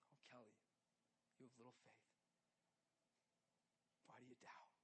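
soft whispered voice of Jesus; whispered self-rebuke. Retold or imitated. Kelly hearing Jesus quietly question her doubt. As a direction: hush it like an inner voice.

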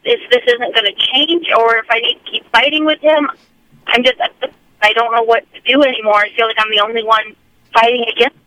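ordinary talk

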